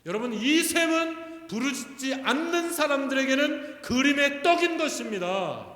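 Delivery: loud, high, impassioned proclamation; full-force preaching. Real, not acted. Preacher tells congregation whom the spring opens for, preaching at full force. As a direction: drive each phrase home to the congregation at full voice.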